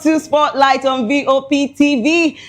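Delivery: bright, slow announcer welcome; show host opening. Acted. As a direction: greet the viewers like a TV host.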